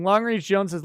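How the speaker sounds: ordinary talk, nothing marked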